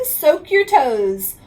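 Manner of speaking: usual speaking voice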